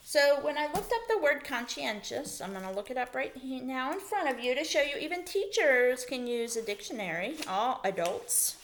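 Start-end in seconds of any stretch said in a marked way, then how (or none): none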